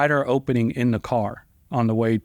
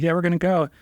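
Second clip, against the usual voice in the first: mock nonchalant; 7 semitones higher, faster than usual. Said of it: "I'm all nonchalant about it".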